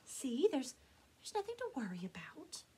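whispered, nervous reassurance; nervous self-talk. A narrator's reading. Elmer to himself, whispered nervous. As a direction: talk yourself calm.

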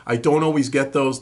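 ordinary talk

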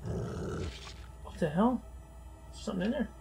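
slow, breathy whispered what the hell; spooked whisper. Real, not acted. Whispering to his friend after spotting something in the room. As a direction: whisper it, unnerved, drawing it out.

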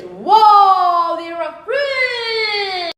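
amazed shout. Acted. Repeats amazement.

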